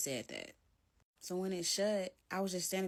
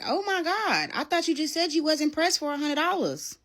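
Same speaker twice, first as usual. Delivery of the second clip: much higher, loud sarcastic disbelief; incredulous mockery; 10 semitones higher, 10 dB louder than usual. Taunting him after he snatches the money, high sarcasm. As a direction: needle him with it. Mocking his stinginess.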